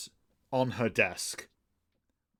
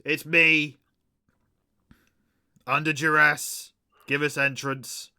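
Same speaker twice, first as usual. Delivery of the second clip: raised voice, called up to a guard; projected reply, a little higher; calling out; 4 semitones higher, 6 dB louder, slower than usual. Acted. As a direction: call back loudly to the gate. John Hancock answers the megaphone from outside.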